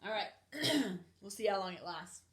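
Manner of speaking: usual speaking voice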